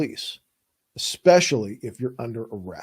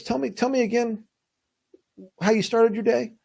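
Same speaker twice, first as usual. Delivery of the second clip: higher, probing cop voice; mock interrogator pressing again; cop asking again; 8 semitones higher than usual. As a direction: press the suspect to repeat his story.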